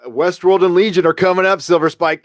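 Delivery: ordinary talk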